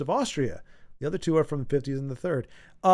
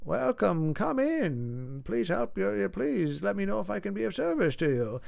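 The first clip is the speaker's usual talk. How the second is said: put-on old shopkeeper voice; 4 semitones higher, 6 dB quieter than usual.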